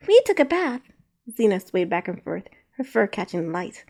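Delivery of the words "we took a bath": put-on high, loud small child; little girl voice. A narrator's reading. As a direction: chirp it like a young girl. Little girl Zena speaks.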